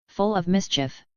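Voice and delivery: ordinary delivery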